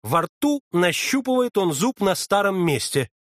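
ordinary delivery, nothing marked

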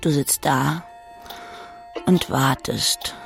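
usual speaking voice

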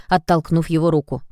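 usual speaking voice

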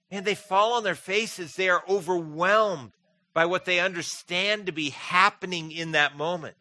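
preaching at full force. Acted. Preaching builds to a peak.

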